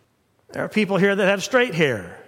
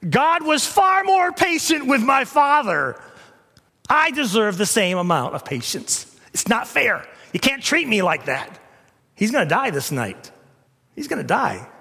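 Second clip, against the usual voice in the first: much higher, urgent pleading; protesting king; 8 semitones higher than usual. Retold or imitated. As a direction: whine like a spoiled king. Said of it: "He could have said".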